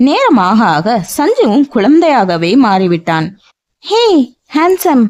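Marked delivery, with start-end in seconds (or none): 3.8-5.0 put-on childlike call. A narrator's reading. Childlike woman calls bodyguard.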